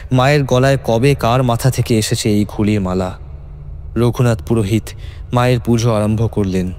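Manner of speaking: ordinary delivery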